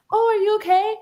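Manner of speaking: high, concerned mom voice; a hint of an anxious mother; worried mom. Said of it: "my poor mother was like".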